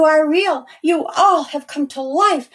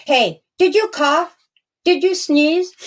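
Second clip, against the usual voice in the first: plain reading voice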